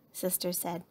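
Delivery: usual speaking voice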